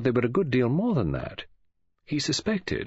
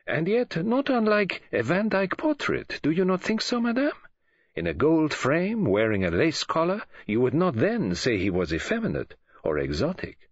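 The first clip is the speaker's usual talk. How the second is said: higher-pitched, courteous foreign gentleman; Poirot; 7 semitones higher than usual. A narrator's reading. Poirot compares the youth to a portrait, courteously. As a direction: reason gently and formally with madame. Voices Poirot reasoning with Mary.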